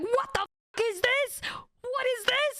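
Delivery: high, incredulous what-is-this; shocked disbelief. Retold or imitated. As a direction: act baffled and offended at the gift. Friend's shocked reaction retold.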